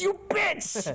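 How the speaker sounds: high, shrieked mock outrage; fake-betrayed squeal at her. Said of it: "And I was like".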